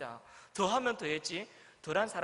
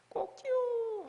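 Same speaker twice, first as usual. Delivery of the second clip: high mock rooster crow "꼬끼우"; much slower than usual. (닭 울음 흉내)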